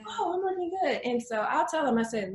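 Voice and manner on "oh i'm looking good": high-pitched pleased imitation; newly confident client. Client whose confidence is coming out, delighted high voice. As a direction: voice a client delighted with herself, much higher. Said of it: "their confidence is starting to come out".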